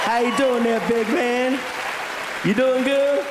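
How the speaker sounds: a hint of a Texan drawl; lightly voiced McConaughey. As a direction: lightly do a laid-back Texan star. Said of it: "his accent's the same".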